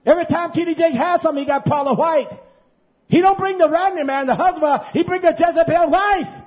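very high, loud sermon tirade; full-force preaching. Real, not acted. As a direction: thunder the accusation at the congregation, voice right up.

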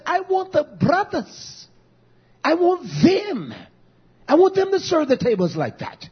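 high, slow, hammering on want; emphatic stress. Real, not acted. Pastor insists to the congregation he wants the brothers serving, emphatic. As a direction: lean hard on each want.

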